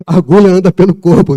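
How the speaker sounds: loud, high mock old-timer warning; mock grandma warning; old folks' warning. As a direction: imitate the old superstition, hammed up. Mimicking old folks' saying.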